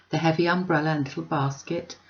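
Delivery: ordinary delivery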